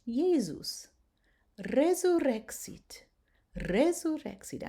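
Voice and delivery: "resurrexit" with a rolled R and a Z sound; "Jesus" with a Z, "resurrexit" with a trilled R. Shown as a demonstration. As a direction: roll the R, buzz the S as Z. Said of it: "I'm rolling the R".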